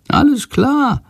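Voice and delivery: high, squeaky grumble; grumbling bird voice. A narrator's reading. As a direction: grouse like a cheeky little bird. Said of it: "antwortet Shandor".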